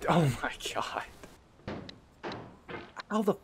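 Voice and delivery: breathless whisper; a defeated whisper; whispered despair. Real, not acted. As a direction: let it out barely voiced. Despair after a fall.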